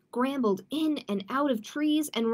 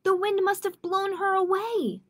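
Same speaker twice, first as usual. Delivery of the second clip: high-pitched wail of alarm; urgent storybook panic; panicked call; 8 semitones higher, 4 dB louder than usual. Acted. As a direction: keep it pitched up in panic to the end. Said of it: "called down in a panic".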